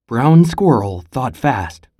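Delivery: usual speaking voice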